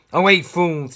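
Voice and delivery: the furious king drives out the magicians, shouting; shouted. Acted. Bellow them out of the room. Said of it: "he shouted".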